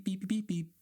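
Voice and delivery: high, mocking beep beep; imitated phone beeps. Comedian mocking texts from friends, high mock beeping. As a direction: chirp the beeps like a nagging phone.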